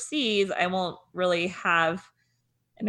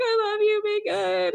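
high, teary farewell to the dogs; tearful goodbye; 13 semitones higher than usual. Retold or imitated. Dog mom to her dogs, high teary goodbye. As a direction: coo it like you're about to cry. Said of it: "start tearing up".

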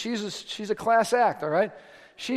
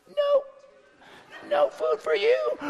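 mock squeaky tantrum; much slower than usual. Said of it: "take away a little food".

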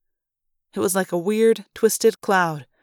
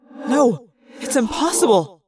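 shocked disbelief; 3 semitones higher than usual. A narrator's reading. Pilot disbelieves what he sees.

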